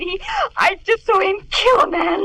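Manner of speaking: crying out the horror through sobs; sobbing terror. Acted. Terrified maid telling her mistress, sobbing. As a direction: let the fear break into tears.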